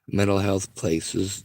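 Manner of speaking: normal talking voice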